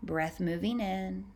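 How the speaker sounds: calm, unhurried meditation voice with long pauses; guided meditation. Acted. Guiding listeners to tune into their breath, calm meditative voice. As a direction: ease the listener into noticing each breath.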